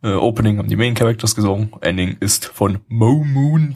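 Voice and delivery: usual speaking voice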